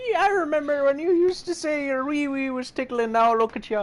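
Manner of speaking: high, loud giggling granny; laughing grandma voice. Acted. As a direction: tease through laughter like a granny.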